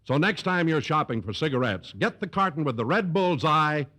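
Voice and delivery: smooth, confident announcer pitch; commercial read. Acted. Announcer reading the Lucky Strike ad to listeners, polished sales voice. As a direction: sell the cigarette like a radio sponsor spot. Don reads the sponsor commercial.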